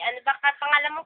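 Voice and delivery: ordinary delivery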